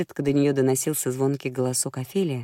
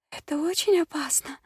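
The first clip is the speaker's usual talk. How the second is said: испуганная дама; 12 semitones higher than usual. A narrator's reading. Испуганная Прилс.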